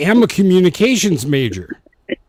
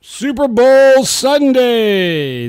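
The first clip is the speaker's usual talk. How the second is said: announcer voice; 8 semitones higher, 6 dB louder, much slower than usual. Acted. Announcer-style drawn out.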